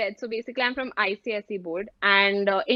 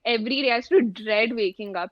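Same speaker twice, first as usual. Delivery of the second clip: ordinary talk, nothing marked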